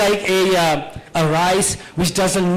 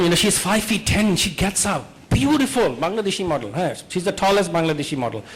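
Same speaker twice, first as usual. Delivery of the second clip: ordinary delivery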